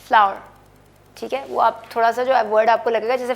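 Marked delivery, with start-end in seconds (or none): 0.1-0.4 फ्लावर said like flour; model pronunciation. Shown as a demonstration. Teacher builds flour from hour for students. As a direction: add fl to आवर and say फ्लावर. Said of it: "अगर एफ़ एल लगा देंगे तो साथ".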